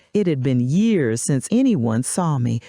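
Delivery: plain reading voice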